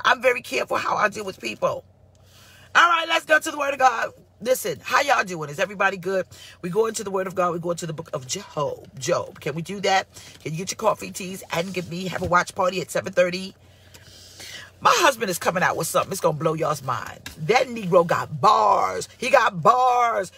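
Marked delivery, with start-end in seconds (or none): 2.7-4.1 higher, louder shout to the crowd; rallying call. Real, not acted. Pastor calling her live audience to scripture, loud shout. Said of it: "calling the live audience". 17.4-20.3 slow, loud drawn-out hype. Real, not acted. Wife hyping her husband's new music, slow and loud. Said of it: "hyping husband's music".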